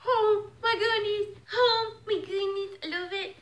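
high, overwhelmed gushing; real giddy delight; too excited. Real, not acted. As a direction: gasp it out, high and thrilled. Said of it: "I'm too excited right now".

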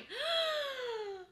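sharp high gasp of disbelief; excited gasp. Real, not acted. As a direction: gasp as the price keeps climbing. Market keeps running, high gasp.

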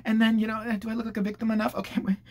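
mock victim. Influencer fussing over looking victimised, mock pitiful. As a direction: play up fake victimhood. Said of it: "She made this whole diatribe about it, like".

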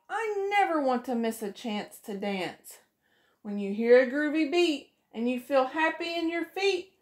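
usual speaking voice

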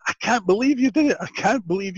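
high-pitched imitation of a thrilled friend; giddy retold amazement, high voice; excited disbelief. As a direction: squeal it as if jumping up and down.

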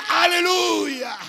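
high, long-drawn shout of praise; overjoyed hallelujah shout. Acted. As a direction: let it ring out slowly like an exuberant worshipper. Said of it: "ponerse demasiado contento".

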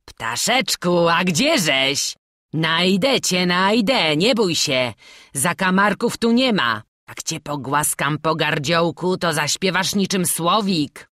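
sinister, jeering coo; mocking. A narrator's reading. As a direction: taunt a hiding victim with fake sweetness. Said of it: "drwiący głos Bartnika".

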